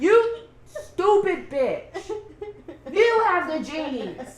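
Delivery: hammed-up furious sister, breaking into laughter; mock angry insult. Voicing Betty scolding brother.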